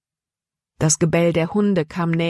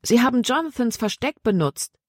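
higher-pitched, excited realisation; excited exclamation; 7 semitones higher than usual. A narrator's reading. Libby telling Paul about the hiding place, excited exclamation. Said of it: "rief Libby aus".

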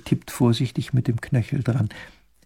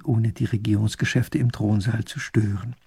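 plain reading voice

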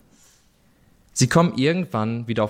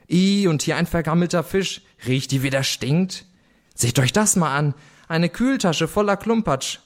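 sister exclaiming; 6 semitones higher than usual. A narrator's reading. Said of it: "rief meine Schwester".

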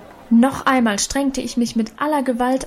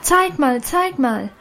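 high excited zeig mal, falling; excited shouting; 4 semitones higher, slower than usual. A narrator's reading. Schoolgirls crowding Annchen, excited calling. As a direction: chant it at her like an eager girl. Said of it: "schrien die anderen Mädchen nun auch aufgeregt".

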